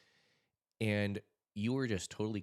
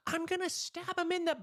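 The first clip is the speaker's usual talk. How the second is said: much higher put-on elderly mother voice; old mom; 5 dB louder than usual. Mother telling her son she'll stab the intruder, feisty.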